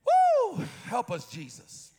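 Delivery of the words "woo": high joyful woo shouted out; excited whoop. Real, not acted. Preacher whooping at the congregation, excited whoop. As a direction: let out a happy whoop. Preacher exclaims mid-sermon.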